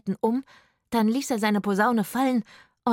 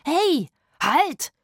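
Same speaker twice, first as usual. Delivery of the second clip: high loud call; shout; 8 semitones higher, 5 dB louder than usual. Acted. Rufus stopping the guard closing the gate, high loud shout. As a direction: yell to stop him. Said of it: "schrie Rufus".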